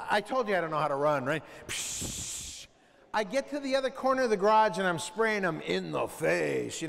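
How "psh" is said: soft hiss of a spray can; spray sound. Retold or imitated. Kid spraying the cockroach, soft spray hiss.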